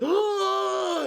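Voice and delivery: squeaky cartoon gasp; high mock gasp. Acting Superman's gasp, high pitched. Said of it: "he goes".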